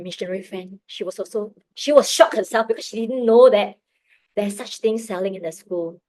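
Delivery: usual speaking voice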